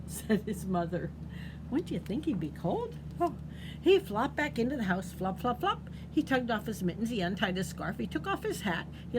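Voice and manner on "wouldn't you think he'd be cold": usual speaking voice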